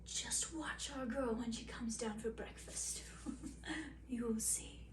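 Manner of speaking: whispered secret with a chuckle; queen whispering to her son about the test; conspiratorial whisper. Acted. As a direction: hush it like a plotting queen.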